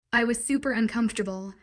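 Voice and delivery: plain reading voice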